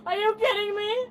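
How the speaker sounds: sobbing disbelief; tearful, hurt question; crying. Real, not acted. Arrested woman sobbing at deputies.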